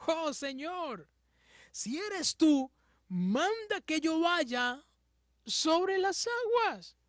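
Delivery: high, slow, pleading cry; Peter pleading. Acted. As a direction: beg the Lord to call you onto the water. Peter pleading to walk on water.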